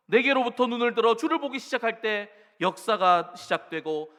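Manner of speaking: loud preached lyric; proclaimed lyric. Acted. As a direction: proclaim the hymn line. Hymn line preached loud.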